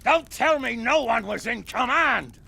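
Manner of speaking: loud, indignant demand; angry outburst. Acted. Commander raging at his men over the missing enemy, angry. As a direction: rage at finding the enemy gone. Commander furious at empty camp.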